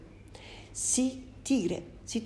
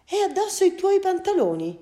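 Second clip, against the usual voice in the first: puzzled father; 12 semitones higher, 5 dB louder than usual. Acted. Father notes son's clothes.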